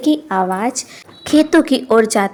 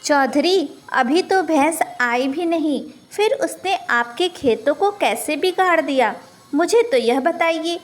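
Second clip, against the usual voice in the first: softer, higher, pleading with folded hands; a meek old man begging; pleading meekly; 4 semitones higher, 5 dB quieter than usual. A narrator's reading. Old man pleads with the chaudhari, soft and higher. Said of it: "हाथ जोड़ते हुए कहने लगा".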